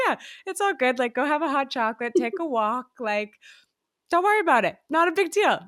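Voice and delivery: breezy, brushing the failure off; dad's easygoing reassurance. Retold or imitated. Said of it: "And he was like".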